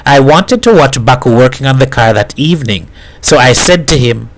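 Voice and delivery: ordinary delivery, nothing marked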